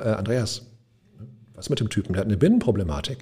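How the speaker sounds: low, soft voice of reason; lightly voiced angel, quietly talking him down; a hint of a calming conscience, hushed and low; good shoulder angel. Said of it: "Linke Seite auch, ein Männlein, das sagte, oder so ein Engelein".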